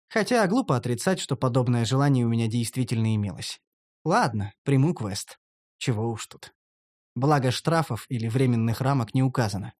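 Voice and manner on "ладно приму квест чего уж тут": ordinary delivery, nothing marked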